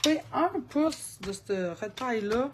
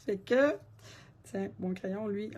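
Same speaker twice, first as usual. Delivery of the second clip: ordinary delivery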